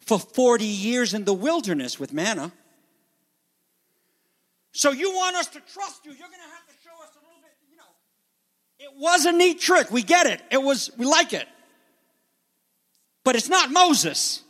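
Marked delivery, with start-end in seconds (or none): 4.7-14.4 high, loud mock crowd challenge; skeptical crowd voice. Crowd dismissing Jesus' miracle, high and loud. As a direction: brush him off like an unimpressed crowd. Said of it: "what they mean by that is".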